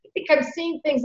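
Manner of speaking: put-on high, soft, doubting old man; imitated unsure uncle. Uncle asking his niece whether he is seeing things, imitated high and soft. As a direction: lightly act out someone questioning his own eyes. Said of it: "only next time it wasn't as severe".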